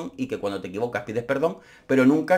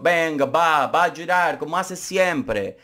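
mocking taunt; 3 semitones higher, slower than usual. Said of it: "ese fue el comentario de Michael".

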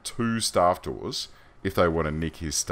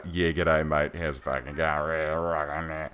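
slow, drawn-out ocker drawl; extremely ocker Australian; 4 semitones lower, 3 dB quieter, much slower than usual. Retold or imitated. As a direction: exaggerate a broad ocker Australian accent, dragging the last words. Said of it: "busts out an extremely ocker".

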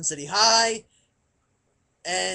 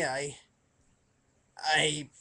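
ordinary talk

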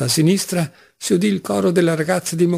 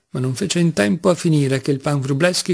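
usual speaking voice

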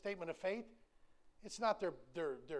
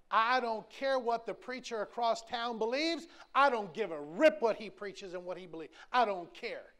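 preaching at full force; 4 semitones higher, 8 dB louder than usual. Acted. Preacher to congregation, loud defiant preaching. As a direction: pound each phrase from the pulpit.

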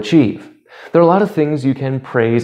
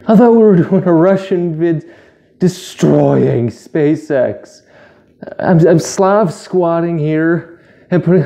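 mock Russian vlogger; 5 semitones higher, slower than usual. Voicing the expected Russian video.

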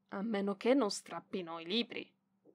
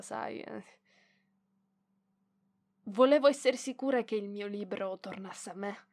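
usual speaking voice

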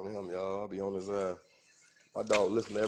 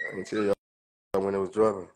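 usual speaking voice

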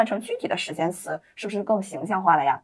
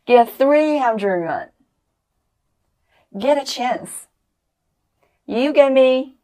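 slow, deliberate teacher drill, every word clear; slow drill read-aloud; 3 semitones higher, 4 dB louder, much slower than usual. Shown as a demonstration. Teacher leading students in a slow, clear drill. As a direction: read each phrase slowly for the class to repeat. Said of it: "和新华式读一下吧，注意和我把动作加起来".

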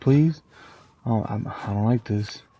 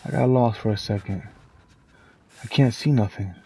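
ordinary delivery

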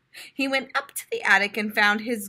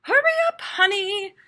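high, louder motherly hurry; Mother Turtle voice; 10 semitones higher, 4 dB louder than usual. A narrator's reading. Mom hurrying her son off to school, high and brisk. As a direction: urge him along like a busy mom. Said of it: "said Mother Turtle".

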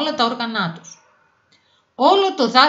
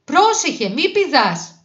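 high, loud call to stop; teacher calling out a warning; urgent warning; 7 semitones higher, 4 dB louder, slower than usual. A narrator's reading. Teacher warns squirrel before jump. (επείγουσα δυνατή προειδοποίηση)

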